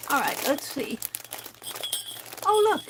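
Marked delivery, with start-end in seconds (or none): 2.4-2.8 bright delighted exclamation; light up at what you see; excited. Real, not acted. Delighted at Christmas items.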